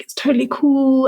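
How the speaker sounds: high-pitched, breezy send-up of being fine; mock all-cool. Mimics falsely positive attitude.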